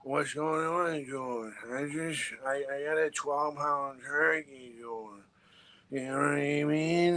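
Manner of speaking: slow, hushed, spaced-out addict voice; junkie voice. Pretend. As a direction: drawl it like a strung-out junkie. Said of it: "that junkie voice that I do".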